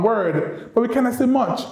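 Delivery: ordinary delivery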